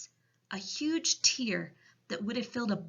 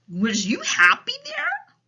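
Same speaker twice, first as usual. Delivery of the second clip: loud, higher, concerned giant voice; troubled storybook giant; Big Friendly Giant; 9 dB louder than usual. A narrator's reading. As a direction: ask with big pitying emphasis. Giant questions Sophie.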